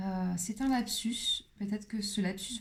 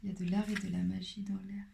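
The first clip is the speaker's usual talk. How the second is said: whispered; 4 dB quieter, faster than usual. Shown as a demonstration. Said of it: "same line said plainly before".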